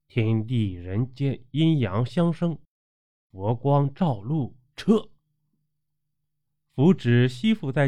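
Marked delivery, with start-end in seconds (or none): 0.1-5.1 recited incantation. A narrator's reading. Meizi casting a talisman spell on Jing'er, recited incantation. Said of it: "念道".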